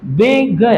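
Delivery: बिग split into two beats, बि-ग; two syllables. Shown as a demonstration. Teacher showing a class the two syllables of बिग, syllable by syllable. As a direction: say बिग in two separate pieces. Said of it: "दो खंड में बोल रहे हैं".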